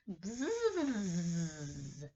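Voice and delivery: a long buzzing hum like a bee; buzzing bee noise. Shown as a demonstration. Teacher shows kids a bee: buzzing hum. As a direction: buzz through the lips like a bee. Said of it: "It's a bee".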